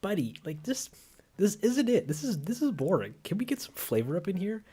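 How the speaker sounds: complaining mouth voice. Pretend. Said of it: "my mouth will just be like".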